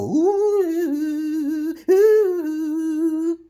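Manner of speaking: high loud wordless ooh; high loud ooh call; oohing sound. Pretend. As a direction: coo out a high loud ooh instead of speaking. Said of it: "You could go".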